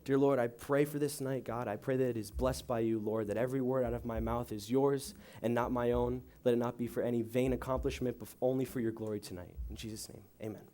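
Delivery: soft, reverent opening prayer; quiet prayer. Acted. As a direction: pray it gently to the room.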